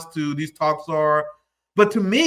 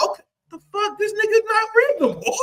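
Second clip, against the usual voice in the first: high, laughing mock outrage; mock disbelief; 13 semitones higher, 4 dB quieter than usual. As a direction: squeak it out in mocking disbelief, laughing. Voicing onlookers' disbelief.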